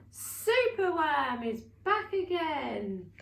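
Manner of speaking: slow, loud, thrilled reveal; excited gasp of relief. Acted. Storyteller announcing Superworm's return to children, thrilled. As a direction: celebrate the hero's return.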